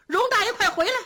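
high call relayed from afar. A narrator's reading. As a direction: shout it down the courtyard. Servants relay the call outside. (远处高声传话)

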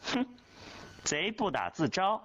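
high, mocking sneer; a triumphant taunt after a scornful 哼; sarcastic jeer. A narrator's reading. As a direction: lame girl gloating at the boy in front of the class. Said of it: "讽言讽语地说".

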